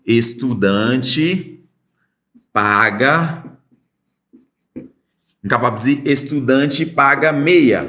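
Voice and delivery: slow, careful Portuguese estudante paga meia; teacher reading a sign; slow demo. Shown as a demonstration.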